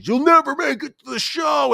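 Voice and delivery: high, loud mocking heckler; taunting fan yelling in his ear; retold heckler yell. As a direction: yell it from the stands at him. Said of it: "just screaming".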